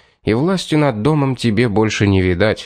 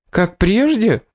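high-pitched, incredulous indignation; indignant cook's squawked question; 11 semitones higher, slower than usual. A narrator's reading. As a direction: throw it back in outrage. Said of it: "с негодованием вопросила повариха".

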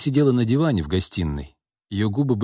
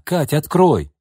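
high, raised, insistent call; acted loud plea through the door; calling loudly; 7 semitones higher, 4 dB louder, slower than usual. A narrator's reading. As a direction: urge your sister to open up. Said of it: "громко позвала она".

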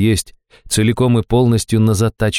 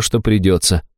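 ordinary delivery, nothing marked

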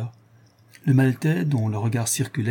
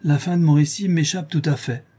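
higher-pitched, mildly feigned helplessness; hypocritical; 7 semitones higher, 5 dB louder than usual. A narrator's reading. Maltese sailor claims he forgot his tale's end, hypocritical. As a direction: make the excuse sound a little too innocent. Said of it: "me répondit-il d'un ton hypocrite".

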